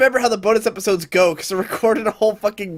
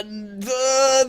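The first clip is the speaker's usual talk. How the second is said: usual speaking voice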